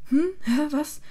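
confused, high and loud questions; startled awake. A narrator's reading. Ron woken by Hermine's yelling, startled and confused. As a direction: jolt awake, baffled.

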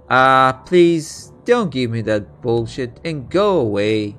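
loud exasperated brush-off; angry shout. Acted. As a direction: shout at her to leave. King shoos off fortune teller.

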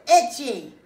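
high yell with a long-held middle word; very loud complaint. Acted. Child protests at ballet lesson, very loud. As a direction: stretch it out, then burst out the last word. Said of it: "But I was very loud".

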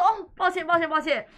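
loud, high, hammed-up apologies; loud mock apology. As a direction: beg forgiveness, overplayed and loud. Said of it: "他直接跪下说".

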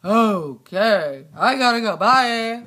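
low rumbling monster asking; deep monster voice. Pretend. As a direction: boom it out like a big beast. Same deep voice leaving.

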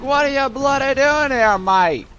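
high, indignant cartoon Aussie soldier; hammed-up Australian outrage; Australian accent. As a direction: challenge an intruder in broad mock Australian. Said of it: "imagining these guys have Australian accents".

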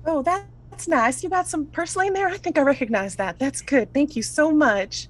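high-pitched grateful character voice; high and appreciative; kindly Ursula. Acted.

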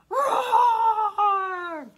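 loud playful roar; dinosaur roar. Pretend. Children asked for the word.

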